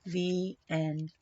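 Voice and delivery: usual speaking voice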